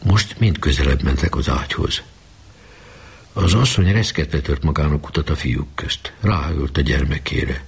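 ordinary delivery, nothing marked

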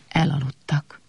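ordinary delivery, nothing marked

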